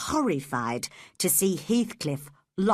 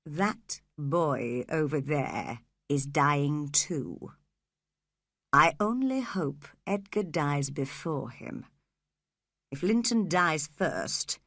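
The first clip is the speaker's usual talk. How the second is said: a lightly voiced cruel father plotting; cold scheming man; 5 semitones lower than usual. A narrator's reading. Heathcliff speaks low, cold.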